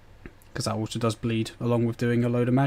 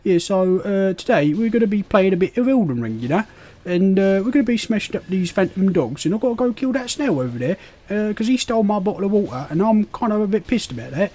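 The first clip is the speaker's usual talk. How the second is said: higher, hammed-up cockney geezer voice; cockney accent; 6 semitones higher than usual. Streamer offers chat a cockney accent, hammed-up cockney. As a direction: lay on a cheeky cockney accent. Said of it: "I can go a bit cockney".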